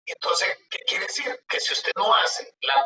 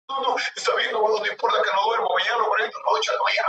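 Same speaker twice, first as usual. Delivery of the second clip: eager promise; 5 semitones higher, faster than usual. Retold or imitated. Relieved client promising to file.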